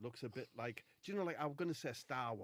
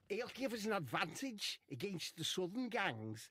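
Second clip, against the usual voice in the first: put-on higher-pitched, failed Scouse accent; Wade Rooney; 7 semitones higher than usual. Comic voices Wade boasting about his robot, bad Scouse. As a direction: have an unconvincing go at Scouse, pitched up. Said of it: "that's not Scouse".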